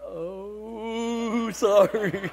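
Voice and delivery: long drawn-out "oh" into a high, comic sorry; sheepish apology. Retold or imitated. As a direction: shrink back in mock contrition. Retold sheepish apology after rebuke.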